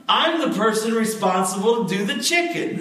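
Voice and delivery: high voice breaking into laughter; the chicken lady putting the governor in his place, laughing; laughing sassy lady. Retold or imitated. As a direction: deliver the line while laughing. Church lady retorts, laughing.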